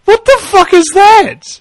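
high, drawn-out mock outburst; wife's retold outburst. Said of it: "She's going".

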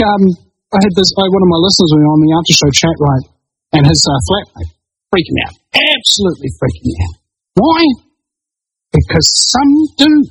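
ordinary talk, nothing marked

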